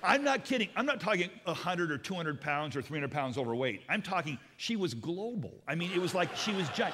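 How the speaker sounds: loud, high, laughing exaggeration; over-the-top comic buildup; excited build. Real, not acted. Comic exaggeration of huge seatmate.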